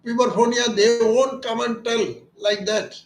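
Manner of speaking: ordinary talk, nothing marked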